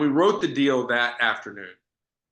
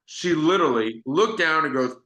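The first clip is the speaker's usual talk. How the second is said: usual speaking voice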